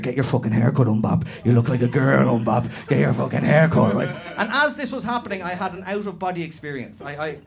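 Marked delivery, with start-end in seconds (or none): 0.0-4.0 low, loud growl; snarling bully. Retold or imitated. Rugby player pinning him down, low loud snarl. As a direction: growl it while choking him. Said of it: "he's like".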